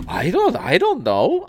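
high, drawn-out mock innocence; feigned cluelessness. Grandson acting out grandma denying she knows, feigned cluelessness. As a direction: imitate grandma playing it off, slow and high. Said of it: "played it off like she had no freaking idea".

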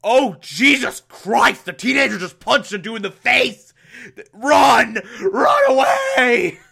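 high panicked yelling, slow; panicked shout. Retold or imitated. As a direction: scream to run away. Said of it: "Clock King's like".

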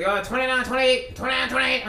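high, sing-song score readout; the judge reading out scorecards, lightly mocked. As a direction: rattle off the numbers like the announcer. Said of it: "and he's like".

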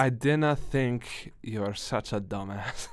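slow, put-on Scottish brogue; trash-talk in a Scottish accent; mock-insulting Scottish voice. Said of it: "talking shit in a Scottish accent".

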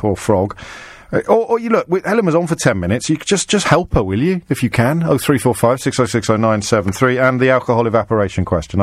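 normal talking voice

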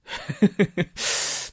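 short breathy burst of laughter; amused, breathy chuckle; laughing. Real, not acted. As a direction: laugh briefly at your own words. Laughs at own wish to enjoy it.

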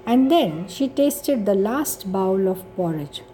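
ordinary delivery, nothing marked

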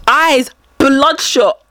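slow, punched delivery on "bloodshot"; dramatic emphasis. Real, not acted.

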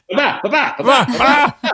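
high silly babbling; mocking his own train of thought; scattered mind noise. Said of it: "my mind works of just".

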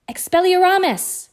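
loud, high cast of the spell; a loud high shout of the spell; croaked spell. A narrator's reading. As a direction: fling the spell out at full volume. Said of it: "he croaked".

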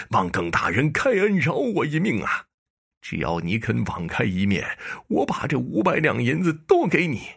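broken magistrate pleading with the prefect to spare him; begging for mercy. Acted. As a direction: grovel and beg for your life. Collapsed culprit begs for mercy.

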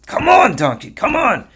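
high, straining call to a donkey; exasperated urging. Acted. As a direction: urge it on in acted frustration. Bilam hits donkey to move it.

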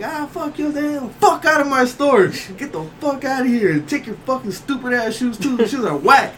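high, heated retold taunting; trash-talking. Teller shouting insults at the guy behind his car, high trash talk. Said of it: "He's talking shit".

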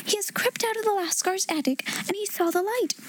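high-pitched, eager discovery; thrilled girl breaking the news; excited cry. A narrator's reading. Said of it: "she cried out".

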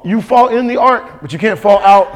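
ordinary delivery

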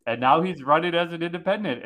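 ordinary talk, nothing marked

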